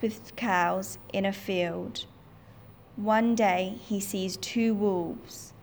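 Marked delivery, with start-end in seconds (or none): none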